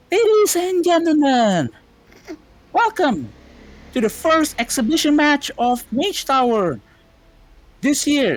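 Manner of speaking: booming high announcer voice, drawn out; fighting game announcer. Acted. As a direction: hype up the arena crowd. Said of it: "like, an announcer voice, like, an M Z voice".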